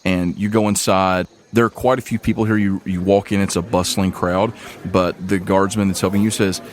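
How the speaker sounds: ordinary talk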